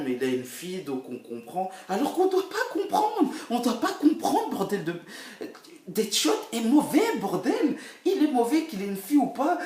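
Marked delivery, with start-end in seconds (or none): 1.9-9.6 high, loud exasperated outburst with laughs; exasperated rant. Real, not acted. French vlogger ranting to viewers, loud and high, laughing. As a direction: rant half-laughing that he's just bad. Ranting about Deadshot being bad.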